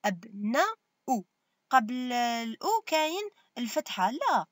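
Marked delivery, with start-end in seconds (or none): 0.0-1.2 "أب نا أو" split into three separate syllables. Shown as a demonstration. Teacher breaks a word down for pupils, syllable by syllable "أب نا أو". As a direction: sound out "أب نا أو", a pause after each part. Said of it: "يقول لك شوف النون نا".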